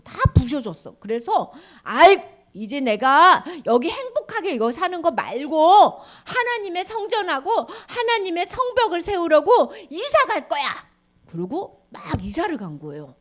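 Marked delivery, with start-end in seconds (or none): 1.8-10.9 loud, high, determined; eager people. Acted. People resolving to rebuild temple, eager.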